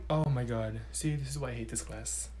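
ordinary talk

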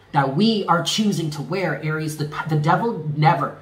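ordinary talk

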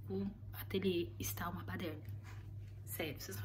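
whispered confession about the messy studio. Real, not acted. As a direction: breathe it out secretly, voice gone. Confides studio mess to viewers. (sussurro de confidência)

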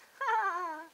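high, loud cackling laugh; witch cackle. A narrator's reading. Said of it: "The witches roared with laughter".

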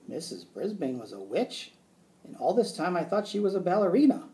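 plain reading voice

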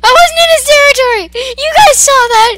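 panicked high-pitched shriek, very loud; shrieked frantic protest; screaming. Real, not acted. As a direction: scream it at the top of your lungs. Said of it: "I will scream at the top of my lungs".

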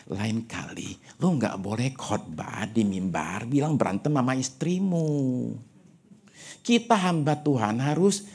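a hint of a hushed, slow senior pastor; lightly voiced elder pastor advising quietly; senior pastor's advice. Senior pastor over dinner advising the young preacher, hushed and slow. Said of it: "terus bilang gini, kasih nasihat".

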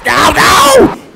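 high, loud crash scream; panicked shout. Real, not acted. Driver sees a car coming, panicked shout. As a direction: yell as if about to collide.

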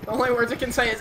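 ordinary talk, nothing marked

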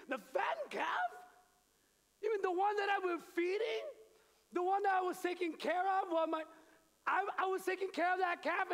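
high, loud indignant disbelief; outraged older son. Acted. Older son protesting to the servant, loud and indignant. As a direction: cry out in wounded outrage.

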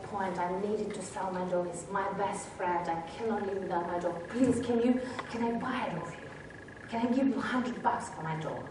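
soft, low retold plea; desperate pleading. Penniless seller begs buyer for his dog back, pleading. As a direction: plead quietly, as if imitating someone humbled.

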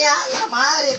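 startled shout. Retold or imitated. Startled by passing car.

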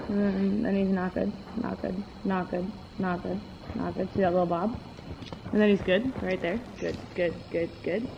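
low murmured running commentary; quiet muttering. Real, not acted. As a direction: mutter it under your breath. Watching horse's gait, muttering.

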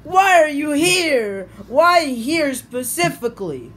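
normal talking voice

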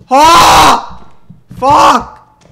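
high loud scream then curse; startled scream. Real, not acted. Gamer screaming when a monster ambushes him. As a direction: scream as the monster jumps you.

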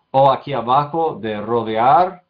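ordinary talk

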